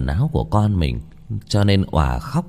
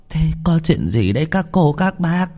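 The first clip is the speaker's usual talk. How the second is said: much higher, anxious quavering question; trembling old woman; 9 semitones higher than usual. Acted. Old grandmother anxiously asks the villagers, high and trembling. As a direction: call out frightened to the crowd. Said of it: "run rẩy hỏi to".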